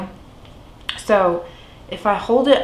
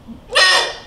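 hiccup gasp; 11 semitones higher than usual. Real, not acted. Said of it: "Nope, they're not".

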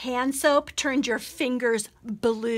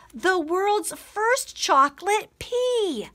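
higher, slow, amazed discovery; delighted; 6 semitones higher, slower than usual. Acted. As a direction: savor the words, thrilled. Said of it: "popped one in her mouth and said".

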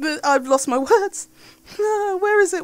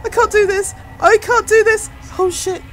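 panicked; 7 semitones higher than usual. Real, not acted. Panicked repeating.

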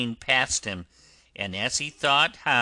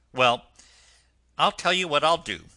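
loud, quick, cheerful offer; hearty engine driver; 6 semitones higher, 9 dB louder, faster than usual. Acted. Driver offering the washerwoman a deal, brisk and hearty.

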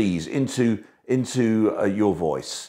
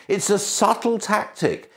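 ordinary talk, nothing marked

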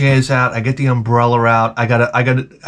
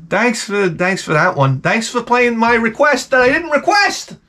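sarcastic thanks; 7 semitones higher than usual. Retold or imitated. Sarcastic thanks for unwanted song.

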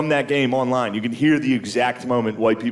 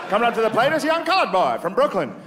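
put-on high old-timey radio call, building; old radio announcer; 9 semitones higher than usual. Comic imitating an old radio announcer calling the home run, pitched high. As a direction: call the pitch like a vintage ballgame broadcaster. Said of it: "You can hear the announcers like".

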